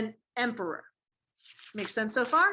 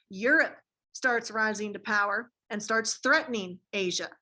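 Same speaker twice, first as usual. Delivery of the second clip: normal talking voice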